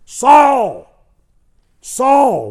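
very high, very loud shout of a name; God hollering. Acted. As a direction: holler it from a blinding light. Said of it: "Just hollered at you".

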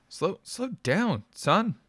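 too much emphasis, trying to calm; anxious father. A narrator's reading. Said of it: "with a little too much emphasis".

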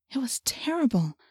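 hushed, breathless whisper. A narrator's reading. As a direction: whisper it in horror. Narrator in awe.

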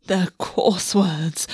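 slow, mildly sulky prince; a touch of royal pouting; petulant. Acted. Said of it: "managed to sound petulant".

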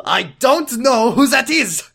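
hammy doctor brushing it off; slow, high pretend doctor; mock doctor voice. Doctor James voiced comically.

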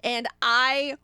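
ordinary delivery

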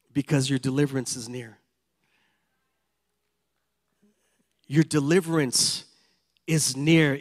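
rising preacher's exhortation; fervent sermon delivery; preaching. Acted. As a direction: preach hope to the congregation. Preaching at full force.

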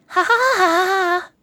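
put-on high, slow mechanical child's laugh; stiff, spaced robotic ha ha ha. Shown as a demonstration. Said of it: "The human child robot laugh, what would that be like?".

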